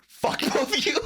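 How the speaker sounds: high, loud mock fury; mom's angry outburst. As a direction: imitate a mother cursing out her sons. Mom curses both sons.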